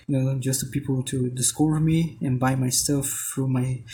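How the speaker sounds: ordinary delivery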